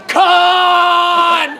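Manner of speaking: long, drawn-out shout to heaven; theatrical cry of anguish; hammed-up cry. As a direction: bellow it melodramatically, holding the vowel. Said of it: "raises his arms to heaven and cries".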